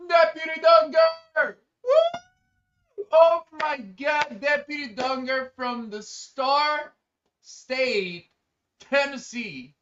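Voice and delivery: loud, slow, drawn-out hype greeting; greeting Deputy Dunger, loud and drawn out; excited shout. Real, not acted. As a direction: welcome him in like a hero.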